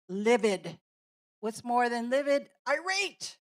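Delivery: loud, emphatic word-ladder demo; teacherly build-up with mild mock anger; escalating intensity. Shown as a demonstration. Acting out rising anger word ladder.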